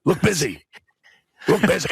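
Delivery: playful talking dog on alert; dog's accent. Pretend. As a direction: put on a dog's voice, amused. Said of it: "I like your dog's accent".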